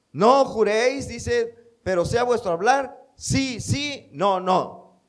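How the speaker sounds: forceful preaching. Acted. Preacher quoting scripture forcefully.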